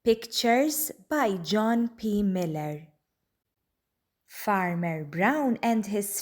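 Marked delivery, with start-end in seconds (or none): none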